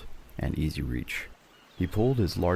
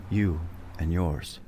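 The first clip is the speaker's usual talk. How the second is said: slow, eerie otherworldly voice; ghostly whisper; slower than usual. Acted. Ghost voice claims Carver and his crew, slow and eerie. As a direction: breathe it like a spirit at his ear. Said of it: "the voice echoed again".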